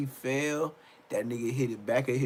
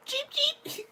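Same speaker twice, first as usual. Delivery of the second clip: much higher squeaky chick peeps; chicken chirp; 3 dB quieter than usual. Retold or imitated. Mocking the fighter as a chirping chicken, high squeak. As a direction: peep like a tiny chick. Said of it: "They said".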